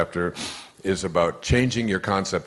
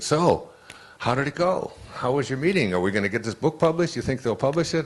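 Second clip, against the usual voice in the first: mock eager agent, higher voice; eager questions; 7 semitones higher than usual. Agent calls after the meeting, eager and higher. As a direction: pepper him with hopeful questions.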